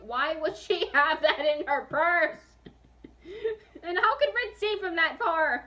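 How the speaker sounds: high, loud laughing rant; laughing disbelief. Real, not acted. Reader laughing at her story's plot holes, loud. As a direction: crack up mocking the plot.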